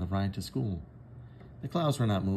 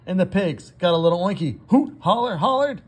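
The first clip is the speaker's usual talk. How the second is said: much higher, louder holler; rowdy; 9 semitones higher, 9 dB louder than usual. A narrator's reading. Teacher voicing rowdy pigs at recess, hollering. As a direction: let loose the pig noise.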